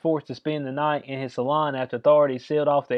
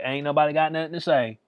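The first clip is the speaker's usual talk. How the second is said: ordinary delivery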